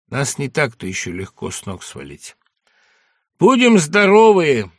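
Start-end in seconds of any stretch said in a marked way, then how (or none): 3.4-4.7 forced hearty toast. Acted. Toast at funeral wake.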